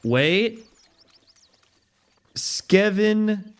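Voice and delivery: ordinary delivery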